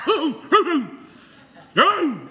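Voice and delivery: big, high burst of laughter; loud, hooting laugh; loud whooping laugh. Real, not acted. Whoops at his own story.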